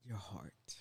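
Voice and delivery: slow whisper. Real, not acted. Cohost recalling his point, slow whisper. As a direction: breathe the words slowly.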